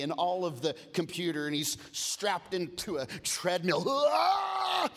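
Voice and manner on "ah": very high, loud strained shout; mock effort yell; straining roar. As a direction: roar like a boxer pushing through a workout. Drago straining on the treadmill.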